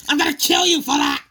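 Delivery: fast, loud furious threat; enraged engine bellowing a threat; furious shout. Acted. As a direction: roar the threat as he charges. Diesel enraged by insult.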